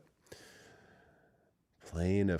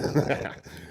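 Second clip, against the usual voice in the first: laugh; 9 semitones higher than usual. Real, not acted. Laughs at the driver's excuse.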